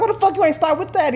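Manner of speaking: very high, loud squeal of outrage; outraged disbelief. Retold or imitated. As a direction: cry foul at the price jump. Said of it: "He was like".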